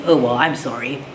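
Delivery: ordinary delivery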